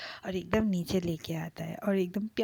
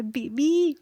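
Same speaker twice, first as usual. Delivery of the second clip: loud call with a higher pitch; shouting from below; 5 semitones higher, 6 dB louder, much slower than usual. A narrator's reading. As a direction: call up to him from downstairs. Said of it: "नीचे जाके चीखता है".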